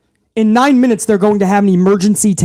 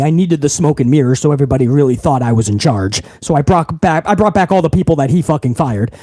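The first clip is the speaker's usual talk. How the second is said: mock boss confession; 9 semitones lower than usual. Mock wrestling boss admits the lie to his talent, low voice. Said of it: "they're doing it again and going".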